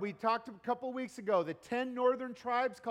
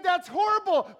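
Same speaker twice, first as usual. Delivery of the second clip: higher, louder shocked friend; loud, high mock horror; 8 semitones higher, 7 dB louder than usual. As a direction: gasp it out as if appalled. Friend reacts in joke.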